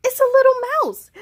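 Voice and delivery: frightened surprise at a mouse; high startled child; startled gasp. Acted. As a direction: gasp then high, loud.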